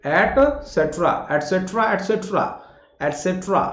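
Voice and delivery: एटसेटरा repeated as "et-se-tra"; correct pronunciation drill. Shown as a demonstration. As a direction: drill एटसेटरा, the first one slow. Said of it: "बोलकर देखिए".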